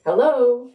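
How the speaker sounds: high, loud sing-song hello; sing-song call. A narrator's reading. Bible man greeting an unseen Haru, sing-song call. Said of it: "calls out in a sing-song voice".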